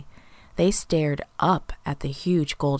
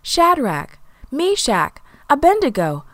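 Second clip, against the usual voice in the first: calling out; 10 semitones higher, 5 dB louder than usual. A narrator's reading. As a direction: call each name across a distance. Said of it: "the king called".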